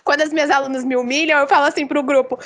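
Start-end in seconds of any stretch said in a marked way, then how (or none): none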